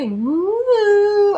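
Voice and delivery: quick high whoop of delight; excited whoop. Real, not acted. Reader delighted by the goddess card, high whoop. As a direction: cheer the card's message.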